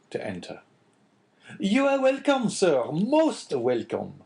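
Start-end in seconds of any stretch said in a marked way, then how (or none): 1.6-4.2 high, loud, drawn-out host's greeting; astonished innkeeper, pitched well up; astonished welcome. A narrator's reading. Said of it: "repeated the astonished Caderousse".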